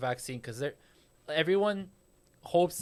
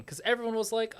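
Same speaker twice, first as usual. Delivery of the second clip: ordinary talk, nothing marked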